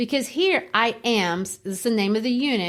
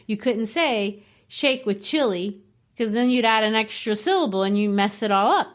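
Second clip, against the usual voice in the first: usual speaking voice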